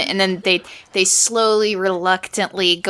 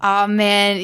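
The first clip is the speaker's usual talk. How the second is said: ordinary talk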